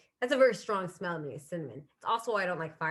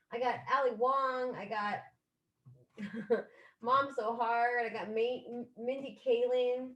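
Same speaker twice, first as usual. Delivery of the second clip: ordinary talk, nothing marked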